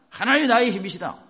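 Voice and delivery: loud, high proclamation of faith; bold declaration. Acted. Preacher modelling a confession against despair, bold proclamation. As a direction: declare it with full conviction.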